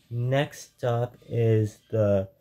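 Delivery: ordinary talk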